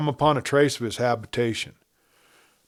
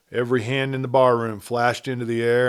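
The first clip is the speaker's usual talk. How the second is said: usual speaking voice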